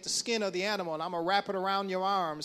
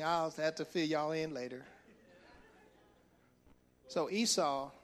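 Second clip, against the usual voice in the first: ordinary talk, nothing marked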